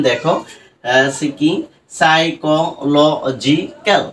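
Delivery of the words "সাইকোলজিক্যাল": সাইকোলজিক্যাল stretched out very slowly, one syllable at a time; teacher sounding out সাইকোলজিক্যাল syllable by syllable; broken into syllables. Shown as a demonstration. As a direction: pull সাইকোলজিক্যাল apart into separate beats. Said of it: "আমি ভেঙে ভেঙে উচ্চারণ করেছি".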